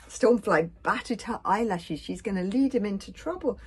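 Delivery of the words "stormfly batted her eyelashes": ordinary delivery, nothing marked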